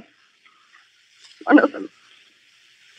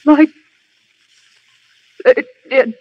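dying words. Acted. Stabbed woman gasping her last words.

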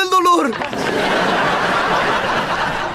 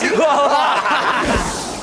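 evil laugh from the gut; 2 semitones higher than usual. Acted. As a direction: laugh from the belly like a villain. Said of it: "No, no, no, desde aquí".

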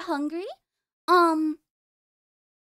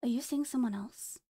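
hushed hurt; 5 semitones lower, 8 dB quieter than usual. Acted. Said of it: "repeats the question".

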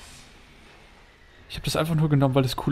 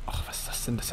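whisper; 2 semitones lower, 8 dB quieter, faster than usual. Real, not acted. Whispering at a huge building.

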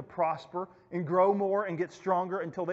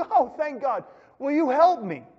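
acted relieved plea; 7 semitones higher, 6 dB louder than usual. Dangling man pleads with God.

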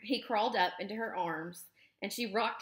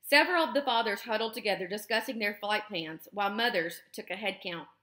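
usual speaking voice

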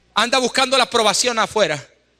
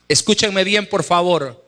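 ordinary talk, nothing marked

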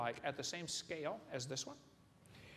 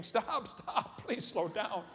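higher, a little louder mock panic with a laugh; kids screaming to stop; 4 semitones higher, 3 dB louder, much slower than usual. Scared kids begging the football players, mock panicked yell. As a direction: beg them to stop, half laughing. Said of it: "But now they're screaming".

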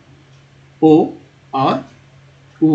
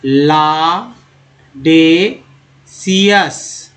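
लाडेसियस stretched out very slowly; extra slow model; very slow demo; 3 dB louder, much slower than usual. Shown as a demonstration. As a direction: draw out लाडेसियस sound by sound. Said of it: "next देखिए".

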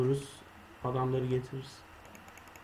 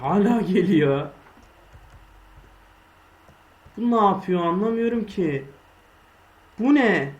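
higher, louder frightened cries; panicked yelling; 6 semitones higher, 6 dB louder than usual. Real, not acted. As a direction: yell in panic as it chases you. Chased by mobs. (panik içinde bağırış)

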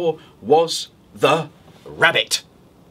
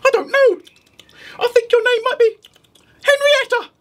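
very high, louder squeaky rabbit; rabbit asking; 4 dB louder than usual. Acted. Rabbit at the witch's door, squeaky asking. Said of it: "said the rabbit".

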